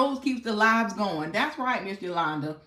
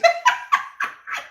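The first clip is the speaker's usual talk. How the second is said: a high, breathy squeal of laughter; squealing laugh at a friend's joke; 6 dB louder than usual. Real, not acted. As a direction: let out a high-pitched giggle. Laughing at trucker buddies.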